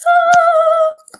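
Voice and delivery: high, loud, drawn-out "ahh" of bliss; blissful sung "ah". Real, not acted. Freediver recalling the joy of surfacing, blissful high "ahh". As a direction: let out a high singing sigh of joy.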